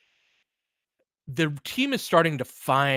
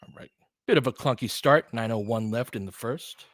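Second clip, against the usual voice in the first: running game call, steady and brisk; live play-by-play. Acted. Streaming the Lakers game to his viewers, live play-by-play. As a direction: call each possession as it happens.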